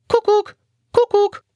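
sehr hoher Vogelruf; Kuckucksruf. A narrator's reading. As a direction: ruf wie ein Kuckuck. Kuckucksvogel ruft. (hoher Kuckucksruf des Vogels)

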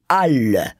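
"Alle" said loud and hard, falling; great emphasis. A narrator's reading. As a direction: hit "Alle" with force. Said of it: "wiederholte er mit großem Nachdruck".

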